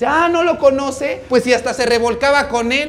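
loud, high acted jeer; taunting yell. Paola exposes Roselena's affair in the street, taunting yell. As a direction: shout the exposure mockingly.

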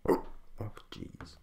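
hiccup cuts the words off; barely voiced hiccup gasp. Real, not acted. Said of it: "I seem to hiccup".

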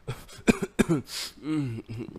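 a sick man's coughing fit; sick brother coughing before he speaks. Acted. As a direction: cough as if ill in bed.

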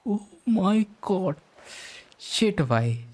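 usual speaking voice

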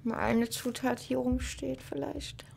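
whispering. Real, not acted. Gamer muttering to himself.

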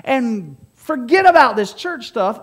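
high, loud mocking of smug churchgoers; hammed-up self-satisfied believers; smug churchgoers mocked. Preacher mocking his congregation as smug churchgoers. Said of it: "His people that said".

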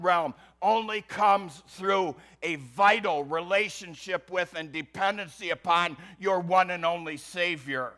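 loud, high, impassioned sermon delivery; building, emphatic preacher's proclamation; forceful preaching. Real, not acted. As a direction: drive it home from the pulpit at full force.

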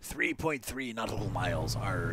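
put-on salty old sea dog growl; pirate voice. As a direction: play it like a pirate captain. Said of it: "No more pirate voice".

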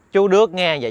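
plain reading voice

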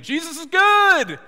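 shouted high, drawn-out praise; mock proclaiming. Pastor proclaiming to two hundred fifty people, a loud forced shout. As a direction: belt it out like a forced declaration.

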